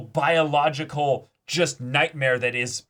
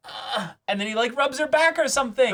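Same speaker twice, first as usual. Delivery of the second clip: worked up; 12 semitones higher than usual. Real, not acted. Podcaster getting worked up about the anime's realism, pitched high. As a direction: rant with rising excitement.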